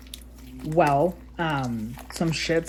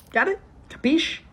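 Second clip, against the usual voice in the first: much higher, punchy demand for agreement; pointed check-in; 13 semitones higher than usual. Real, not acted. Telling girlfriend not to mock the ritual, pointed and high. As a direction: nail it down, sharp and high.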